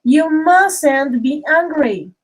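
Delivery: slow, deliberate model sentence; slow teacher demo of mustn't. Shown as a demonstration. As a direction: spell it out slowly for the class. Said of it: "So look at this".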